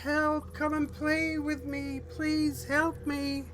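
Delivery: put-on high, pleading little child voice; fluttering cries for help. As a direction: beg sweetly like a lost child.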